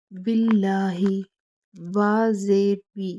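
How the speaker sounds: बिल्लाही recited slowly with a firm doubled ल; बा जेर बी spelled out slowly; slow recitation. Shown as a demonstration. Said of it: "बा को आपने लाम के साथ मजबूती से मिलाना".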